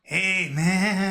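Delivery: lightly put-on stoner drawl; a hint of a laid-back surfer dude; imitating the guy. Correcting his friend's retelling, mimicking the guy's voice. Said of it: "That's not how he talked. He talked like".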